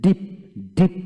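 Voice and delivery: "ডিপ" with a long ee vowel. Shown as a demonstration. Teacher models "ডিপ" for learners with a long ee. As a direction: lengthen the ee in "ডিপ". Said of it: "double ইতে দীর্ঘ ই উচ্চারিত হয়".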